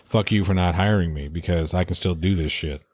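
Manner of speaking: normal talking voice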